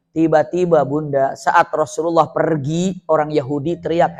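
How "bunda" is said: ordinary talk, nothing marked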